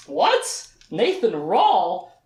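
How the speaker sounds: high what, then stretched name; shocked disbelief. Acted. Richard learning Nathan's surname, high drawn-out disbelief. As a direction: echo it in mock astonishment.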